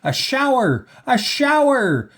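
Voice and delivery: high, loud, squealing cheer; children's excited scream. A narrator's reading. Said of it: "screamed Missus Ralph's four children".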